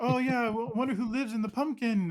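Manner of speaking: high, bright and sugary; sweet agent voice. Retold or imitated. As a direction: coo it like a cheerful picture-book fan. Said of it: "my agent sees it as this sweet, cute".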